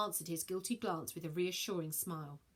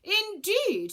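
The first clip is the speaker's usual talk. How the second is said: haughty; 13 semitones higher, 9 dB louder than usual. Acted. Aquarius haughty.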